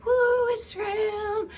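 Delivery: shrill high whoop; mock cheer. Vlogger mocking the news with a shrill fake cheer. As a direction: squeal it like a fake fan.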